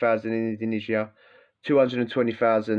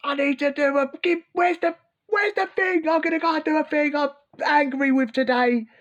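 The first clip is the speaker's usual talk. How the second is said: much higher, hammed-up cartoon villain searching for his weapon, peaking in a yell; mock angry robber. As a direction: play a silly caricature of an angry criminal. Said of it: "out the wrong side of the bed and gone".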